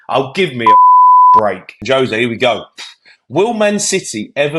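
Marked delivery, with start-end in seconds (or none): 0.1-1.8 loud, slow exasperated groan. Real, not acted. Pundit fed up with sin bins, exasperated and loud. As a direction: drag it out, fed up.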